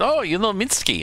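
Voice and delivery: quoting the friends. Retold or imitated. Friends surprised she knows Minsky, high surprise. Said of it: "they said".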